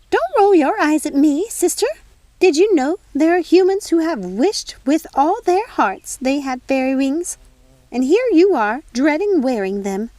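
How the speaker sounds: a busy fairy godmother fussing over tea; fussy fairy godmother. Acted. Fairy godmother chides Nanny.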